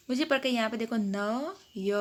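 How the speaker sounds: ordinary delivery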